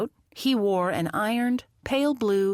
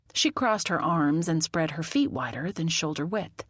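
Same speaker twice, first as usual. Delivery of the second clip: ordinary delivery, nothing marked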